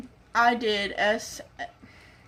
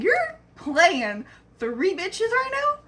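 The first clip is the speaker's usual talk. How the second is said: higher, slowed, indignant disbelief; mock outrage; 9 semitones higher, slower than usual. Confronting the cheating boyfriend, high indignant disbelief. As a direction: demand it like you caught him red-handed. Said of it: "I was kinda mad at this point".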